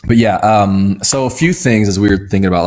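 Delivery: ordinary talk, nothing marked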